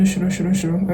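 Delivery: tossed-off imitation of hurried chatter; mock rapid-fire talk. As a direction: run the nonsense syllables together, mocking rushed speech. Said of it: "Don't talk too fast".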